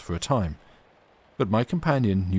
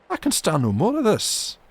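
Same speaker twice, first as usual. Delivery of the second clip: much higher, loud exasperated cry; cried out; 11 semitones higher, 5 dB louder than usual. Acted. Davy protests to Alan, loud exasperated cry. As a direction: burst out, unable to bear it. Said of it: "I cried".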